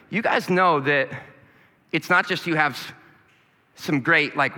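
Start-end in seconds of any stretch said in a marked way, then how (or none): none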